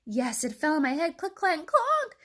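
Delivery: climbing in pitch to a loud high clonk; excited report. A narrator's reading. Chick answering the duck, excited and rising. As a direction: build up to the last knock.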